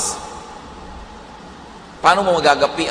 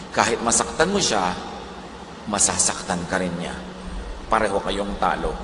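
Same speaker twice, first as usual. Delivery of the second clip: ordinary delivery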